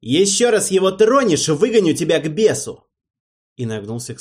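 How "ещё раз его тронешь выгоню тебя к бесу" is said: high, loud furious threat. Acted. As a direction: explode at the servant. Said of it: "демон пришёл в неожиданную ярость".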